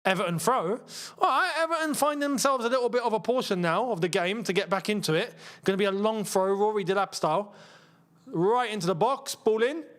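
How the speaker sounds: mock live play-by-play on a match; football commentary. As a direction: call the throw-in like a TV commentator.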